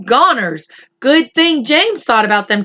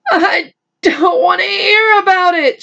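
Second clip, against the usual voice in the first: shrieked; 9 semitones higher than usual. Acted. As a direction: wail it out in terror. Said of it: "shrieked the poor blind earthworm".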